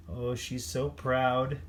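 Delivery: plain reading voice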